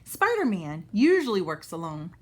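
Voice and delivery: usual speaking voice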